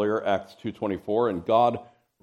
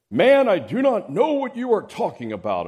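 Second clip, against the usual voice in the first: high, louder protest of denial; Peter's denial; 10 semitones higher, 6 dB louder than usual. Acted. Preacher acting Peter denying to the servant girl, high and loud. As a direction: deny it hotly.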